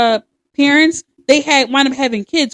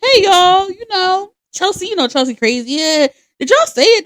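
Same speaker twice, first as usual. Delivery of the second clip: acted confrontation; 8 semitones higher than usual. Retelling confronting boys at school.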